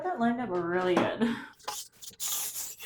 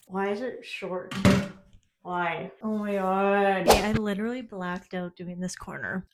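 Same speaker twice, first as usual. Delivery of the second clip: ordinary talk, nothing marked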